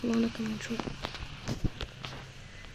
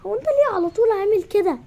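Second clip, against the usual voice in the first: exasperated shout; 9 semitones higher, 7 dB louder than usual. Acted. Girl scolding grumpy brother.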